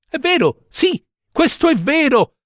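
più acuto e lento, con un sorriso timido; timido e impacciato. A narrator's reading. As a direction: ammetterlo piano, pietoso e impacciato. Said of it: "ammise con un sorriso timido, pietoso e impacciato". (con un sorriso timido, acuto e lento)

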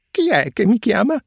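high, loud startled call; frightened. A narrator's reading. Pinocchio calling out frightened to the unseen voice, high and loud. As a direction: puppet jumps at an unseen voice. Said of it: "disse Pinocchio tutto impaurito".

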